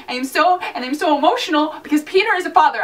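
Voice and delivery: ordinary delivery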